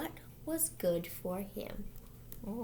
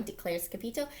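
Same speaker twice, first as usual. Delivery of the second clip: ordinary delivery, nothing marked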